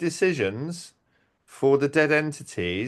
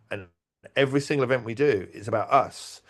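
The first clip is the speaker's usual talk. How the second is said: ordinary delivery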